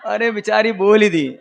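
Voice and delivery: ordinary delivery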